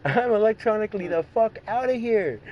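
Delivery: high, loud getaway boast; mock-triumphant high exit line; cocky getaway. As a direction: call it out as you speed off. Playing a fleeing driver.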